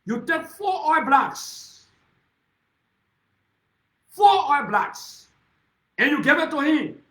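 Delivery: four oil blocks shouted slowly, word by word; slow, loud, outraged denunciation; slow furious shout. Real, not acted. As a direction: hammer each word of the accusation loudly. Denouncing oil block deal.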